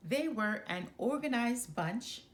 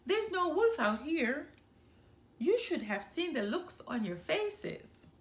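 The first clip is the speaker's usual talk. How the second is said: gleeful; 7 semitones higher, 3 dB louder than usual. A narrator's reading. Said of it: "he laughed".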